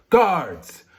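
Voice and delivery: mock commanding shout; imitated summons. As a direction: call out for the guards like a king. King calls for his guards.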